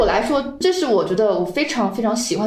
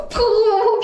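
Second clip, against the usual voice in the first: imitated footsteps; 11 semitones higher than usual. Imitating running downstairs.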